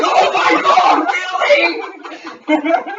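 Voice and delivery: high, loud mock disbelief; hammed-up incredulous cry; retold exasperation. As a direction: drag out an exasperated outburst, breaking into laughter. Teller's retold exasperated reply.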